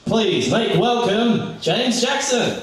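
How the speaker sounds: drawn-out, high MC announcement; announcer intro. Real, not acted. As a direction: announce the poet like a showman. MC introducing poet.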